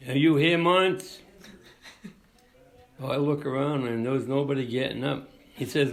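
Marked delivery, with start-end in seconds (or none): 0.1-1.2 mock judge calling out a name, a bit higher and louder; retold yell. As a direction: call the missing defendant across the courtroom. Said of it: "he yells out".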